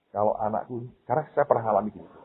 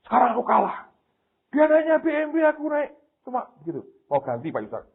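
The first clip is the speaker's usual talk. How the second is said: much higher mock voice of a jealous guest; envious guest; 13 semitones higher than usual. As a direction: play the guest who feels outdone. Voices guest envying the BMW. (tamu yang iri, suara dibuat jauh lebih tinggi)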